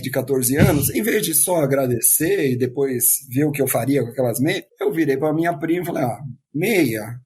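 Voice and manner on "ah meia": low, let-down questioning; disappointed. Retold or imitated. Fourteen-year-old to his cousin over a gift, disappointed. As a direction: react with understated disappointment. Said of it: "Tipo, aquela cara de um".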